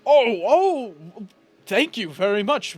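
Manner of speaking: high, loud "oh" of happy realisation; pleasantly startled, voice jumping up; grateful surprise. Acted. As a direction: burst out with delighted thanks. Husband reacts with grateful surprise.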